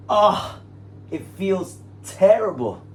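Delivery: pained groan. Real, not acted. Pained during massage stretch.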